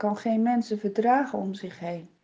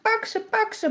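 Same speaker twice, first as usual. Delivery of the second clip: much higher panicked cry; scared shouting; 11 semitones higher than usual. A narrator's reading. Villagers shout at the burning foxes in much higher panic. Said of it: "schreeuwen van angst".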